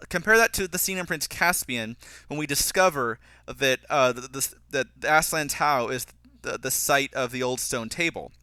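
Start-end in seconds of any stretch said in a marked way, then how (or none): none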